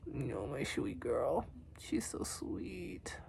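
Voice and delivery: soft, slow coo to a pet; tender cooing. Real, not acted. Cooing softly to her sweet girl beside her.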